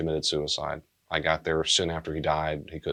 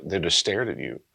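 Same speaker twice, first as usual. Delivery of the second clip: usual speaking voice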